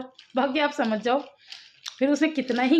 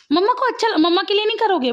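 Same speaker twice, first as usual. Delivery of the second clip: pleading; 7 semitones higher, 4 dB louder than usual. Real, not acted. Mother pleading with daughter.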